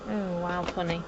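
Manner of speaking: loud amused surprise; surprised. Real, not acted. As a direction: react loudly to something odd. Reacting to odd sound.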